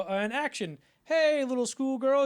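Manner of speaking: high, loud, slow sing-song; take call. Retold or imitated. Crew starting the take, high hammed line. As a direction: call action then ham the line up high.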